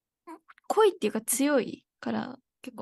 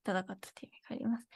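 whisper; 4 semitones lower, 6 dB quieter, much faster than usual. Real, not acted. Whispered end of explanation.

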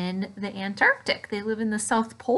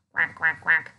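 lower, moderate duck quacks; 6 semitones lower than usual. Shown as a demonstration. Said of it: "Can you make a, a ducky sound?".

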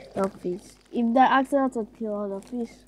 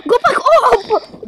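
loud high slow panic; urgent yell, drawn out; panicked shout; 9 semitones higher, 9 dB louder, slower than usual. Real, not acted. Kid panics at danger.

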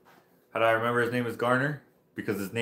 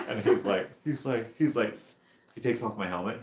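usual speaking voice

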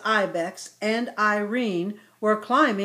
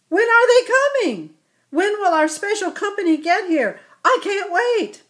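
put-on eager child voice; 11 semitones higher, 8 dB louder than usual.

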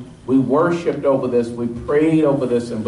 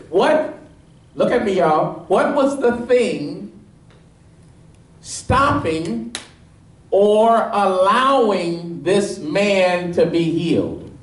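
high, ringing preacher's climb, pressing each point; preaching at full force; 6 semitones higher than usual. Acted. Pastor pressing the congregation on the leper's "If", fervent preaching. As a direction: drive the point home to the congregation.

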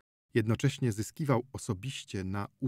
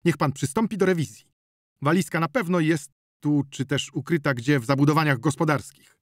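louder, higher, insistent; loud demanding; 5 semitones higher, 6 dB louder than usual. Acted. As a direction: demand the search urgently. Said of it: "zawołał lekarz". (natarczywie, podniesionym głosem)

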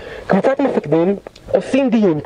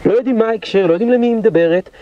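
ordinary talk, nothing marked

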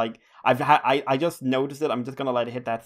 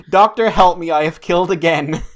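loud, higher mock scream; 4 semitones higher, 11 dB louder than usual. The Irishman running up to the doctor, hammed-up scream. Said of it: "has run up screaming".

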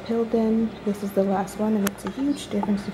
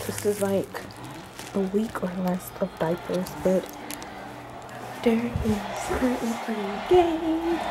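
hushed, much softer talk; quiet voice; 8 dB quieter than usual. Real, not acted. Baby napping nearby.

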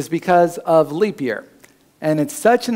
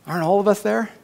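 normal talking voice

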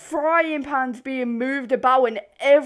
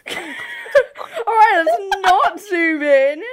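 much higher, laughing through the words, slowing down; 7 semitones higher, 7 dB louder, much slower than usual. Real, not acted. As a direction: crack up mid-sentence, then drag it out.

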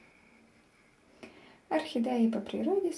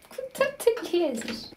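laughing; 6 semitones higher than usual. Real, not acted. Laughing at the cat.

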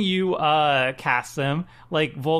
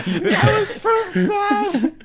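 slow, much higher and laughing; laughing lament; 14 semitones higher, 4 dB louder, much slower than usual. Real, not acted. Host laughs over friend never casting his commander, slow and much higher. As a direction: drag it out while laughing.

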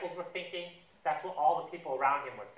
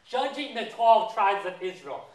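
loud, slow, raised pronouncement; acted-out declaration with weighty pauses; grand proclamation; 4 semitones higher, 7 dB louder, slower than usual. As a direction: proclaim it as a solemn decree. Voices Jesus proclaiming to Peter.

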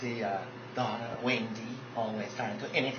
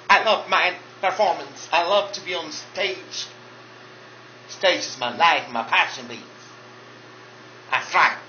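loud, sassy diva voice; sassy drag queen; 8 semitones higher, 8 dB louder than usual. Acted. Drag queen Monica boasting to fans, loud and sassy. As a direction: strut it like a brassy drag queen.

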